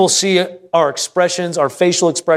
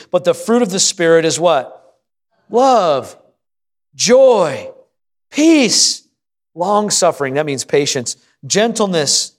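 preaching; 2 semitones higher than usual. Acted. Preacher lists fruits of Spirit.

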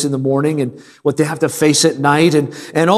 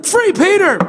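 high, slow, shouted plea; desperate pleading prayer; slower than usual. Retold or imitated. Church begging God to free Peter, hammed-up shouted pleading. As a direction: wail it to the heavens. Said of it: "they're begging God".

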